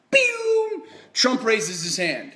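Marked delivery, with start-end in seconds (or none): 0.1-0.8 a sudden, sharply higher shout of triumph; excited exclamation. Acted. Vlogger revealing Trump's move to viewers, shouting in excitement. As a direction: hit it like a bomb going off.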